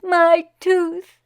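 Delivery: high, loud delighted cry; boy thanking the crowd, high and loud; joyful child. Acted. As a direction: thank everyone gleefully. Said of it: "he said".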